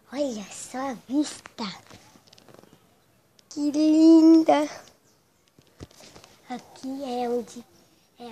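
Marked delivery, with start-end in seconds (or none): 3.5-4.7 a loud, slow gasp of delight; delighted exclamation. Acted. Admiring the view from the rooftop, delighted and loud. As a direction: marvel at it out loud.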